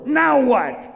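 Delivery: high, impatient "now what"; irritated ruler being interrupted; exasperated king. Acted. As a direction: raise the pitch sharply, fed up. King snaps at interrupting servant.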